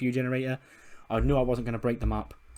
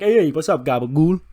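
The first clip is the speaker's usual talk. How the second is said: loud, high swaggering greeting; Tony D'Angelo; 5 semitones higher, 9 dB louder than usual. Acted. Tony greeting Danhausen, loud swaggering wrestler voice. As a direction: hail him loudly, rising at the end. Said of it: "Tony D'Angelo is like".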